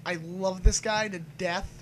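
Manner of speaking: ordinary delivery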